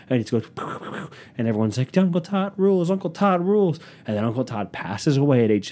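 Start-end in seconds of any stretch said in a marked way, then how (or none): 2.0-3.8 high, loud crowd chant of praise; cheering crowd. Retold or imitated. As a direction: chant it like a cheering family at a barbecue. Crowd cheering for Uncle Todd.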